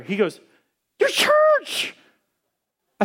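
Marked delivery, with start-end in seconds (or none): 1.0-1.9 very high, delighted answer; spring the surprise gleefully. Retold or imitated.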